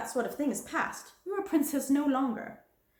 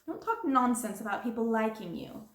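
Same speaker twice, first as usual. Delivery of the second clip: louder, stern warning; harsh dismissal; 2 semitones higher, 4 dB louder than usual. Acted. Stern threat. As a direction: headmistress threatening the girl and sending her off, harsh.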